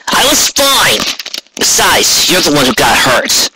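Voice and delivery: Rainbow's voice, protesting. Acted.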